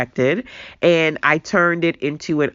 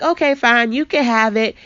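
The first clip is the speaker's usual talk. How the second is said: light, offhand imitation of giving in, pitched higher; mock careless shrug; 8 semitones higher than usual. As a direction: toss it off like a lazy shrug. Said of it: "And I was like".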